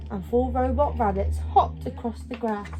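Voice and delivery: ordinary delivery, nothing marked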